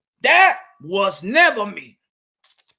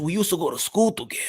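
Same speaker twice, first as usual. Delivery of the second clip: usual speaking voice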